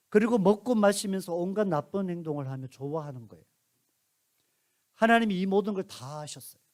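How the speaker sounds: ordinary delivery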